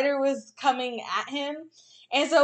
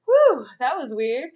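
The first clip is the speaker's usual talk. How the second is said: slow, puzzled sigh of relief as the spider; a lightly voiced spider catching its breath; relieved spider voice; 7 dB louder than usual. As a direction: voice the spider shaking it off, slowly.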